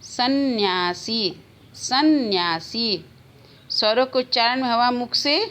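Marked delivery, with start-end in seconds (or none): 0.0-3.0 सन्यासी very slowly, with a held n; careful correct pronunciation. Shown as a demonstration. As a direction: stretch सन्यासी as 'sann-yaa-see'. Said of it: "कौन सा रूप शुद्ध है".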